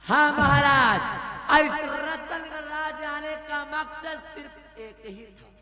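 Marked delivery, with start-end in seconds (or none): 0.0-1.6 loud taunt. Acted. Villain queen taunting king.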